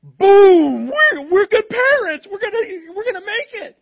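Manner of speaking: high, very loud triumphant cheer; elated parent. Retold or imitated. Pastor imitating a proud parent's joy, high and loud. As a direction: whoop like a parent who just won. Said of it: "And you're like".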